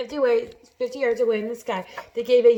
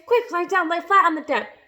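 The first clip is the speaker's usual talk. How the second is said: high, loud urgent order; alarmed, raised-pitch command; cried out; 8 semitones higher, 6 dB louder than usual. A narrator's reading. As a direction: warn everyone to take cover. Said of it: "cried James".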